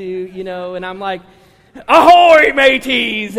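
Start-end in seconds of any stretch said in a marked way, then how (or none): 1.9-3.4 loud hammy pirate call. Retold or imitated. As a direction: bellow it like a pirate. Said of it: "singing songs in pirate voices".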